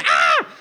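abrupt comic "Ah!" scream; acted scream of horror; mock scream. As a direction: scream mid-sentence, hammed up. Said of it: "Pinocchio screamed".

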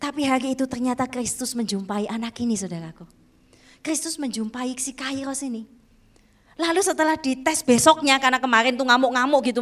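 usual speaking voice

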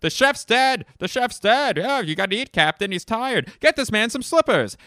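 high, loud fretting crew; hammed-up shouts of alarm for the captain; frantic movie impression. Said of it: "here's my impression of".

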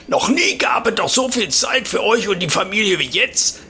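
a know-it-all pensioner holding forth; old neighbour voice. Retold or imitated. Opa voiced lecturing at fence.

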